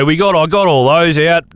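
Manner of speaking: ordinary talk, nothing marked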